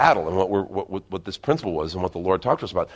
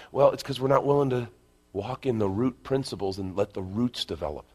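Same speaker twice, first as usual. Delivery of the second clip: normal talking voice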